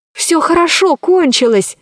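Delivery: put-on high, glad girl voice; relieved and pleased; cheerful. Alice relieved.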